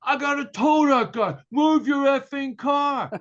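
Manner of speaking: loud, high angry shout; imitated yelling. Tow truck driver demanding the car be moved, yelling. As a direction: bellow it as the irate driver. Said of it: "he's yelling at me".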